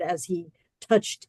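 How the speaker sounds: ordinary delivery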